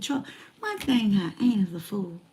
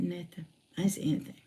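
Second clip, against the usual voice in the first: much softer, tight-lipped denial; quiet denial; 4 semitones lower, 9 dB quieter, faster than usual. Retold or imitated. Answering the detective's question, in a soft guarded denial. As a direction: say it low, giving nothing away.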